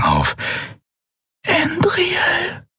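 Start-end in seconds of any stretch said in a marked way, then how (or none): 1.5-2.7 faint, high breathy whisper of her name; breathed whisper. A narrator's reading. Tiny fairy breathing Endriel's name, faint whisper. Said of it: "hauchte sie".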